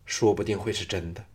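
plain reading voice

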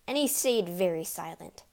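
ordinary delivery

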